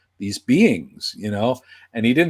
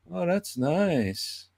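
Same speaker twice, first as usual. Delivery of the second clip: soft, slow and unhurried, a mild impression; lightly voiced old crooner, gentle; slow, quiet old singer's murmur; 6 semitones higher, 6 dB quieter, much slower than usual. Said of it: "Tony's reply, soft and slow".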